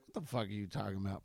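quick, hushed, baffled brush-off; mock exasperated mutter; baffled retort. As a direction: toss it off fast and quiet, dismissive. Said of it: "And I'm always like".

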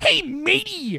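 high, slow hammed-up pirate; high slow mock pirate; wannabe pirate. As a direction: ham up a pirate greeting. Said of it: "wannabe pirate".

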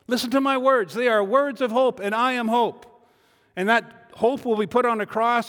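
loud, high, urgent voice of Jesus pleading with the crowd; voicing Jesus. Jesus calling out to the people, loud and pleading. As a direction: speak as Jesus calling people to look at him.

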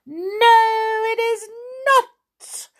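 very high, loud creature shriek; shouting. A narrator's reading. Creature rejecting the first name, shouting. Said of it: "shouted the tiny man".

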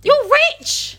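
outraged shout. Real, not acted. Friend shouting at Jekyll's stinginess. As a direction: yell it in disbelief.

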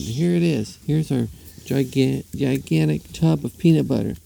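lightly acted woman's announcement; lightly voiced woman. Voices the woman presenting the tub.

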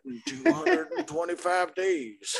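put-on high creaky old-timer voice; cackling old geezer reminiscing; old prospector. Old-timer bit, high far voice.

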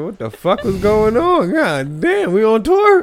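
high, loud, disbelieving; shocked. Retold or imitated. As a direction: blurt it out, bewildered. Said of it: "I said".

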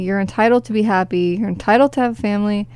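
ordinary talk

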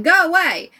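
loud, high shout through a door; mock angry yell. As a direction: imitate a kid yelling to be left alone. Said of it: "Scout's like".

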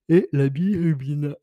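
words stretched by a yawn. Real, not acted. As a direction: say it mid-yawn. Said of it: "je bâille".

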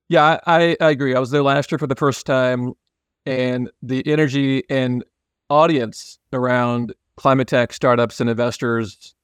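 ordinary talk, nothing marked

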